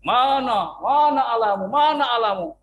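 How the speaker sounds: a loud boastful taunt; taunting giant. Acted. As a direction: jeer at the army as Goliath. Goliath taunting Israel's army.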